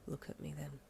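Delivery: low, quiet, coaxing; low and soft. Acted. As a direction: say it low and close. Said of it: "he said lowly".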